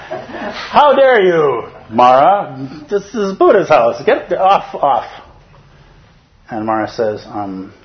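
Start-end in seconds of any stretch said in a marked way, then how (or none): 0.1-5.1 high, loud hammed-up outrage, laughing through it; indignant Ananda. Ananda at the door throwing Mara out, high and outraged. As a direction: scold him off the doorstep. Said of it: "translating directly".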